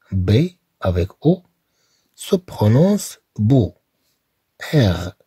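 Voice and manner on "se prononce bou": slow teacher's "bou"; model bou. Shown as a demonstration. Teacher shows how "bou" sounds, slowly. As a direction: draw out "bou" slowly and clearly.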